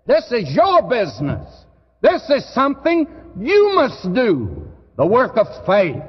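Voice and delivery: fervent pulpit build; rising preaching fervor. Real, not acted. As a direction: press it on the hearers. Preaching climax on your business.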